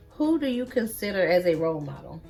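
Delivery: normal talking voice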